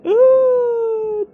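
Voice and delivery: loud high ooh, held long; high squeal. Real, not acted. Reaction at the screen.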